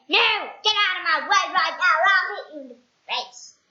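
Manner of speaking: loud, fast rude threat. Acted. Mister Appleseed threatening a man who asks the time. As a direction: threaten him rudely.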